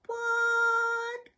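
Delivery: a shocked listener squeaking; baffled. Pretend. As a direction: pretend to be stunned by your own words. Mock baffled reaction to herself.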